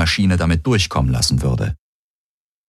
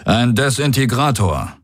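warlord character voice, pitched up; raised commander's voice; Daraios; 4 semitones higher than usual. A narrator's reading. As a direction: explain it as the alien commander. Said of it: "sagte Daraios".

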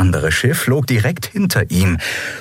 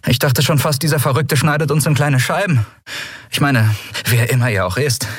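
relieved Andriel; 6 semitones higher than usual. Acted. Andriel talks to the captain.

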